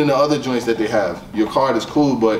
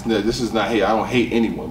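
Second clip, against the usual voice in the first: ordinary talk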